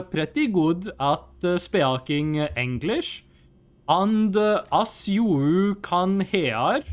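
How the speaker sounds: halting Norwegian-accented English, with lots of "uh"; exaggerated Norwegian accent. Shown as a demonstration. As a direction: demonstrate a thick Norwegian accent, sentence by careful sentence. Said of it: "a real Norwegian fucked up accent. They don't talk like this".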